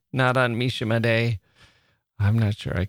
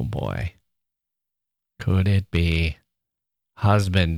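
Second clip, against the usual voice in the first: ordinary delivery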